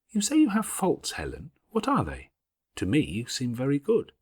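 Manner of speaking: put-on an earnest child asking; young Jane, curious.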